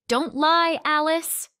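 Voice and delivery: high, loud accusation cutting in; sharp outburst. A narrator's reading. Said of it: "Jenna's voice cut through the line".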